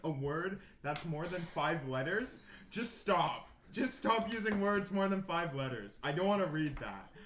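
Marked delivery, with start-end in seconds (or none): none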